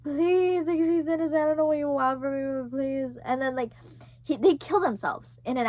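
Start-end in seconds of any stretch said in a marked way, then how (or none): 0.0-3.2 mock begging. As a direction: act out pleading with a captor. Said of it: "I'm, like, begging".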